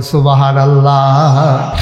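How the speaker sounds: loud, drawn-out praise; slow, ringing call to respond; congregational praise call. Real, not acted. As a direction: stretch it out loudly for the congregation.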